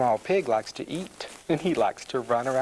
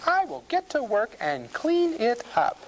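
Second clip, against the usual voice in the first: high-pitched storybook housewife; farmer's wife; 10 semitones higher than usual. A narrator's reading. Farmer's wife planning her cleaning, high and purposeful. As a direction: announce it in a high woman's character voice. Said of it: "says the farmer's wife".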